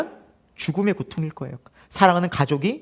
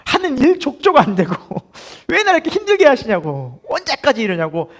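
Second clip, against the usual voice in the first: high-pitched, exasperated mock lament; hammed-up grumbling plea, pitched high; acted pleading complaint; 6 semitones higher than usual. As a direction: whine up at God like a worn-out believer. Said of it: "하소연만 하고 돌아갈 때가".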